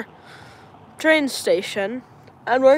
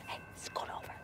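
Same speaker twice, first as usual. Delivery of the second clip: whispering; 25 dB quieter than usual. Real, not acted. Whispering beside live stream.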